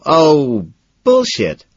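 loud, high bellow, good-natured. A narrator's reading. Patrick bellows to drown out Elizabeth, good-natured. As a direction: bellow it to cut her off, but jokingly. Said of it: "I bellow".

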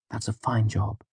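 soft, calm praise; gentle soothing. A narrator's reading. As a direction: soothe like a skittish animal. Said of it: "the same low, gentle tone".

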